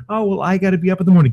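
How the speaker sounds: high, fast, whining mock voice; mocking whiny excuse. Ranting host mocks workers who must be up early, high fast whiny mock. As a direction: rattle it off high and fast like a whiner.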